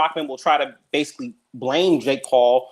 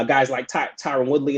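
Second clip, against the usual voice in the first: ordinary delivery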